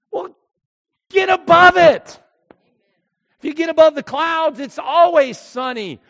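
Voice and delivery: loud, high, impassioned sermon; full-force preaching. Real, not acted. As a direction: preach it out at full volume to the whole congregation.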